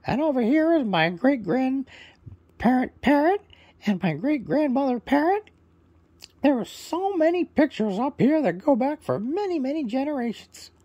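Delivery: high, loud squawky parrot; proud parrot introducing himself, high and loud. Acted. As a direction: chirp it out as a cheerful parrot bird. Parrot shows family pictures.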